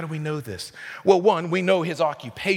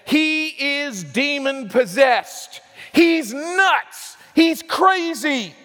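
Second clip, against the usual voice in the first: scornful shout; 6 semitones higher, much slower than usual. Retold or imitated. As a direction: sneer it like an angry mob. Hostile crowd quoted.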